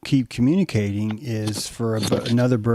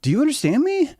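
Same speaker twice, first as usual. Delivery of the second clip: much higher, eager ask; high curious question; faster than usual. Retold or imitated. Bird asking its partner, high curious question.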